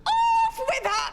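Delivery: high, slow imperious shout; drawn-out, high-pitched shout of condemnation. Acted. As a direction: shriek the order as a royal command. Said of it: "Don't keep shouting".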